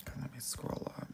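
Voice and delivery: quick whispered aside; whispering. Real, not acted. Whispers an aside while scrolling.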